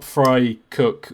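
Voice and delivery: ordinary talk, nothing marked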